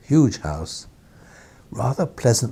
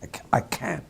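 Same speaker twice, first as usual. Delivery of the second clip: whispered, higher put-on old baron accent; imitating Beaverbrook's accent; 9 semitones higher, 6 dB quieter than usual. Editor redoing Beaverbrook's line in a whispered put-on accent. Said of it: "I'm doing my best to imitate his accent".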